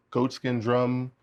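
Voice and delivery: ordinary talk, nothing marked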